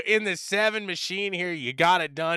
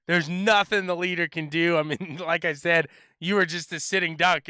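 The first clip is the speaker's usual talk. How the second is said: ordinary talk, nothing marked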